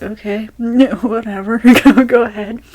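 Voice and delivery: a giggly, drawn-out giving in; laughing retold go-ahead. Telling how they let a classmate carry on, laughing.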